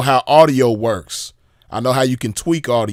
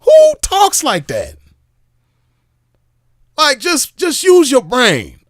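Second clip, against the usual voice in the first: slow, very high and louder incredulous cry; exasperated disbelief; 12 semitones higher, 5 dB louder, slower than usual. Real, not acted. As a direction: squeal it out in outraged disbelief. Exasperated mockery of the recording.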